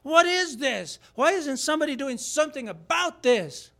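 high, loud outraged cry; a boy appalled at what he hears, voice up high; young David can't believe nobody acts; indignant shepherd boy. Retold or imitated. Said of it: "and he goes".

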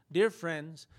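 ordinary delivery

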